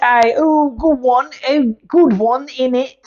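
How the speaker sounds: high, hammed-up cockney chirp; jokey fake British accent, innit; mock British accent. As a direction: play a cheeky English bloke. Mock Brit voicing scouts.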